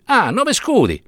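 high, mocking repeat of Nove scudi; mock outrage at nove scudi; scoffing. A narrator's reading. Priest scoffs at the price.

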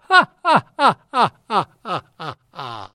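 high mocking laughter fading into the distance; gleeful cackle dying out; laughing her head off. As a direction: laugh while running off far away. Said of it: "ridendo a più non posso".

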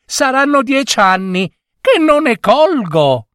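high-pitched, carefree delight; gleeful lady. A narrator's reading. Lady decides to pick cyclamens despite work, gleeful and high. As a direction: chatter on blithely as the happy lady. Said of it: "tutta giuliva".

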